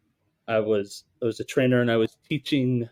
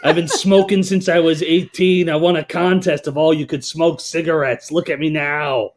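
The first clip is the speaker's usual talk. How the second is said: Gertrude voice; 4 semitones higher, 3 dB louder than usual. Acted. Trainer plays caller Gertrude for trainees, loud and high. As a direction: ham up a chain-smoking old dame. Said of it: "That's an amazing voice".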